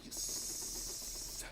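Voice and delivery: hushed whispered triumph; whispered yes. Real, not acted. Player celebrating the Orion answer, whispered yes. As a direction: whisper your victory. Winning on the final guess.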